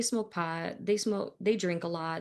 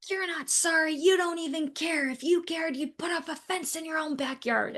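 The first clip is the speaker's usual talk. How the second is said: high-pitched mock angry rant; imitated screaming neighbor; 10 semitones higher than usual. Neighbor throwing an apology back at dog owner, imitated screaming. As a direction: imitate an irate man rejecting an apology. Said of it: "he's still just screaming at me".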